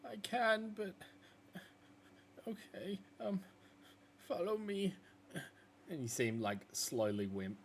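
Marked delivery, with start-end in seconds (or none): 0.1-4.9 high, hesitant agreement; timid wolf. Acted. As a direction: give in reluctantly, small voice.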